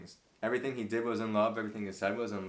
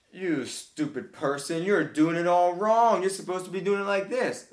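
builds to a loud, higher, scornful tirade; mock condescending scold; 8 semitones higher, 9 dB louder than usual. Mocking a preacher who scolds people, loud scornful tirade. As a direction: rant down at a "stupid person" like a know-it-all.

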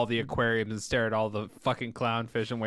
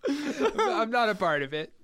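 slow, high words through laughter; drawn-out laughing protest; laughing disclaimer; 8 semitones higher, slower than usual. Real, not acted. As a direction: back out of the joke while laughing. Laughing, backing away from the joke.

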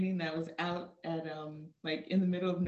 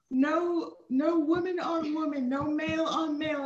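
higher and louder, laying it down; firm instructor rule; 8 semitones higher, 5 dB louder than usual. Retold or imitated. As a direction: state the ban like a strict sergeant.

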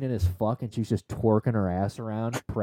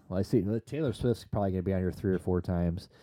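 ordinary talk

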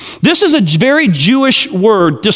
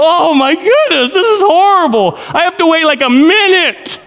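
mock impatience; 7 semitones higher than usual. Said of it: "I'm like".

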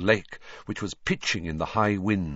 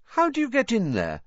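innocently casual; 13 semitones higher than usual. Acted. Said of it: "in an innocently casual sort of voice".

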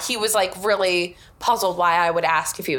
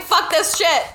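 higher, louder fed-up curse; fed-up outburst; 8 semitones higher, 4 dB louder than usual. Retold or imitated. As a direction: spit it out, completely fed up. Retold outburst at homophobia.

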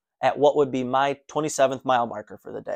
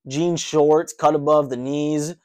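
usual speaking voice

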